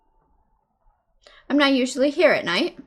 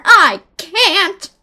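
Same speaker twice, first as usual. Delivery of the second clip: loud emphatic refusal; shouted refusal; 5 semitones higher, 9 dB louder than usual. Acted. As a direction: shout it back at him. Refusing the bartender's request.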